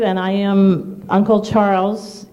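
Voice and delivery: ordinary talk, nothing marked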